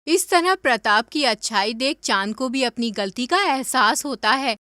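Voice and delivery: usual speaking voice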